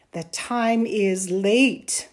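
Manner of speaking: slow, loud insistence; insist, drawing the words out; insistent. A narrator's reading. Mother insists her son come in, slow and insistent. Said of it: "Amal's mother insisted".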